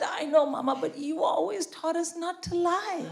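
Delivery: put-on high, slowing little-kid voice; daughter's child voice. Daughter protesting to mother about lying, imitated high child voice. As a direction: imitate an upset child, stretching the words out. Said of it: "She said".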